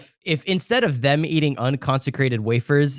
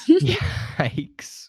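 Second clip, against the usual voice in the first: laughing yikes; 14 semitones higher than usual. Real, not acted. Reacts to joke, laughing.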